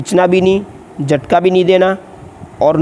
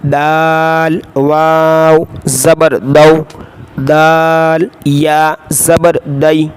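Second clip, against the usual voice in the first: Qaida spelling drill; much slower than usual. Shown as a demonstration. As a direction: call out each letter for the class to repeat.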